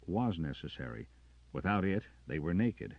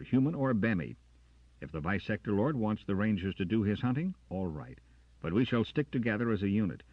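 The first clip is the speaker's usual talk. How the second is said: louder resolute declaration; firm defiance; 6 semitones higher, 7 dB louder than usual. Acted. Carter refusing Jackson, loud and resolute. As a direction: set his stand firmly.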